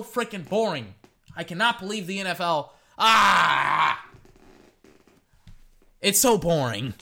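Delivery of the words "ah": loud exasperated yell of disgust; frustrated shout. Real, not acted. Host fed up with boring games, frustrated shout. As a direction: let out a fed-up shout at the schedule.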